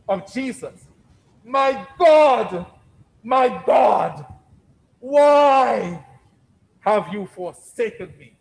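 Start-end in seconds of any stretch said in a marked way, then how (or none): 1.4-8.4 high loud crying out, fading at the end; anguished cry. Retold or imitated. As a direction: cry out to God in anguish, then let it drop. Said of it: "howling cry".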